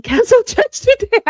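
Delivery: high, loud, breaking into laughter; amused, voice rising into laughter; laughing. Real, not acted. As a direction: say it through a laugh you can't hold. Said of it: "I shouldn't laugh".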